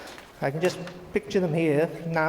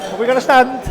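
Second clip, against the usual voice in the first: loud, raised call to the room; 7 semitones higher, 10 dB louder than usual. Real, not acted. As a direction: call out over the crowd. Guests not standing, calls out.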